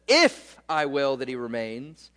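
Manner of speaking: "If" jumps up sharply, the rest stays low; emphatic "If", then settle down; stress on IF. Shown as a demonstration. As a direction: lean hard on "If" to show it matters. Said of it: "they dropped the word if".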